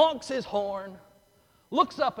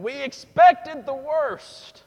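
high, loud and slow, dreading it; mock dread; 9 semitones higher, 11 dB louder, much slower than usual. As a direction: relive the tense moment, stretched out. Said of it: "we're all sitting there going".

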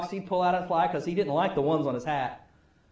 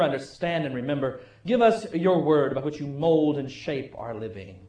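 prayer; 7 semitones lower, 3 dB quieter than usual. Acted. Pastor leads the congregation in prayer, soft and reverent. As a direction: pray quietly over the congregation.

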